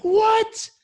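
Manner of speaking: high-pitched shocked what, then a deflating okay; stunned disbelief. Retold or imitated. Student to the master after failing again, high shock then resignation. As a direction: hit the what high, then let it sink. Student refused a second time.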